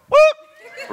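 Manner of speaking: a very high, loud whoop out the car window; playful whoop. Retold or imitated. Husband hollering out the car window to tease his wife, high loud whoop. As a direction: holler it out like a prank. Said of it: "I roll mine down and go".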